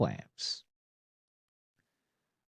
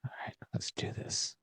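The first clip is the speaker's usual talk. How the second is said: whispered pep talk to self; psyching myself up; 14 dB quieter than usual. Real, not acted. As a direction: whisper yourself into focus. Psyching up before pack.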